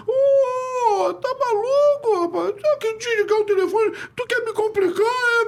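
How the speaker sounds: very high squeaky imitation of the coach; Jair's little voice. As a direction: mimic a high, thin voice scolding on the phone. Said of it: "com aquela vozinha dele".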